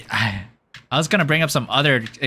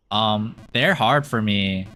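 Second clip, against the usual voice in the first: ordinary talk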